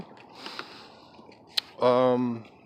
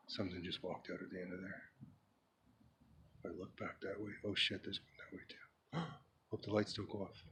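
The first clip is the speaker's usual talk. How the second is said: low, very soft playacted scare; hammed-up hush, as if something might hear; hushed mock fear; 4 semitones lower, 17 dB quieter, faster than usual. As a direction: play spooked, keeping your voice right down. Acted scare out on dark dock.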